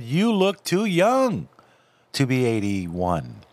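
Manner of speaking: high, slow mock quote. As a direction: drag it out in a put-on voice. Mocking the doctor's quoted praise.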